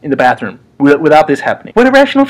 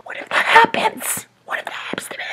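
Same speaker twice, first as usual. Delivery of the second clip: scared whisper; 8 dB quieter than usual. Pretend. Frightened man in dark bathroom, whispering in fear. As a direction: breathe it out in fright.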